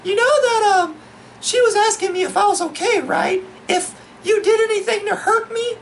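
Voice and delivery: high falsetto girlfriend impression; mock-feminine, questioning falsetto; girl voice. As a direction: imitate a girlfriend in squeaky falsetto. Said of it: "the girl voice".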